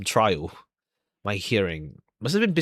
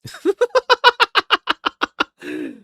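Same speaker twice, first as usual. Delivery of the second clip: loud, high burst of laughter; big laugh; 4 dB louder than usual. Real, not acted. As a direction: throw your head back and laugh out loud. Laughs loudly at the suspect.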